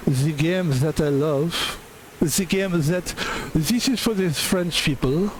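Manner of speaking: a hint of a bad French accent. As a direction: play a cartoon Frenchman, badly. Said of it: "I do speak with a bad French accent".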